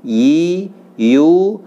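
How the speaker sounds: teacher drilling ya; letter drill. Shown as a demonstration. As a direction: drill ya yi yu clearly.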